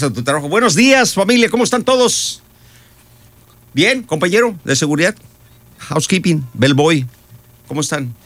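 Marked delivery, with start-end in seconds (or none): 0.5-2.4 high-pitched, sing-out hello; upbeat greeting. Acted. Arriving at work and greeting everyone, upbeat. 3.7-8.1 raised pitch, lively roll-call; cheerful greeting. Acted. Greeting security, housekeeping and bellboy, cheerful.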